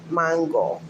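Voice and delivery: mango in a Spanish accent, MAHN-go; Spanish open AH, falling at the end; Spanish pronunciation. Shown as a demonstration. Repeating how she grew up saying it, Spanish accent. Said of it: "That's how I grew up pronouncing it".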